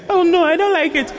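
very high, giggly fake refusal; flirty mock protest. Shown as a demonstration. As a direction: play coy, laughing through the no. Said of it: "As you are saying the "oh no".

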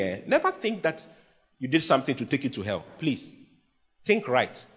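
ordinary talk

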